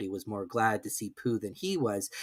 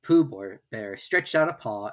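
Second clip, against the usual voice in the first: ordinary delivery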